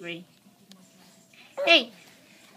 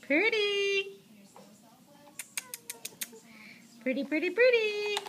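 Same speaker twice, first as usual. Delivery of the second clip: high cooing sing-song; 9 semitones higher than usual. Real, not acted. Cooing at toddler.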